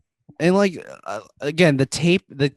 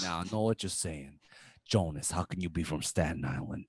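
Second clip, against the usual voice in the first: put-on New York Italian accent; 3 semitones lower than usual. Jonas defends being from Staten Island, New York Italian accent. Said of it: "with a New York Italian accent".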